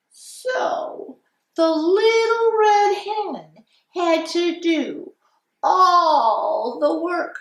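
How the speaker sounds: lilting, sing-song storytime voice; reading to children. Acted. Teacher reading The Little Red Hen to class, storytime voice. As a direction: read it like a kindergarten teacher.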